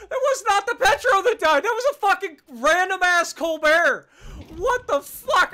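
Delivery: loud, high, incredulous yelling; excited yelling. Real, not acted. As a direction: yell in amused disbelief. Surprise kill, disbelief.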